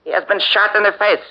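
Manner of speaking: high and quick with shock; lieutenant shocked, reporting a dead guard to the captain. Acted. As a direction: blurt out the horror fast. Discovering the guard was shot.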